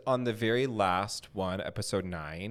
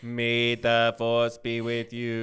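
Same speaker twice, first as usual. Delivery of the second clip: slow, loud, put-on chant; mock solemn quote; 5 dB louder, slower than usual. Host imitating the guest's mixed-up Star Trek sign, mock solemn. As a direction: intone it slowly, mimicking her. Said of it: "She's like".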